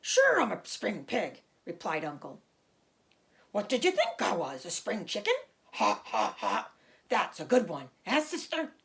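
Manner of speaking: loud, jolly show-off pig; hearty pig voice. Acted. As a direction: play the boastful Uncle laughing at his joke. Uncle's voice in the story.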